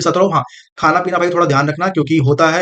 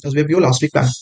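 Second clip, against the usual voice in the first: "SBI PO last week plan" run together smoothly in one quick breath; fluent reading; 3 semitones lower, faster than usual. Shown as a demonstration. Teacher demonstrates to exam students the wrong, fluent rushed reading. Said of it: "अगर कोई बहुत fluent पढ़ेगा तो कैसे पढ़ेगा".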